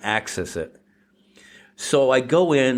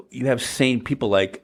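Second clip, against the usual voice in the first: normal talking voice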